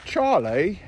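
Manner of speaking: playful British accent on the cat's name. Shown as a demonstration. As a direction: say "Charlie" the British way, lifting at the end. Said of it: "English accent".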